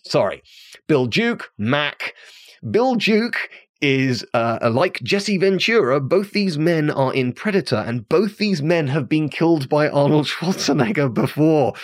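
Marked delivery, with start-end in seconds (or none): none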